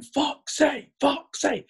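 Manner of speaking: put-on high-pitched chant of a young crowd; cheering kids. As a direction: imitate children chanting a name. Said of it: "to hear those kids going".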